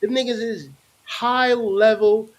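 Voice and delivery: ordinary talk, nothing marked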